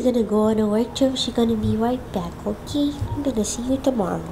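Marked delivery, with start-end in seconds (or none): none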